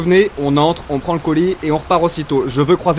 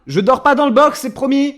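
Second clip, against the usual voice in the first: high, loud protest; loud flustered promise; 11 semitones higher, 6 dB louder than usual. Acted. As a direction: shout it through the closed shutter. Talking through shop shutter.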